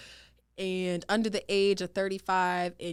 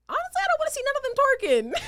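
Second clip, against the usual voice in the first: very high, fast, giggly squeal; squeaky amused protest; high-pitched protest; 5 dB louder, much faster than usual. Real, not acted. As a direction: blurt it out high and fast, about to crack up. Giggling at the twerk-off challenge.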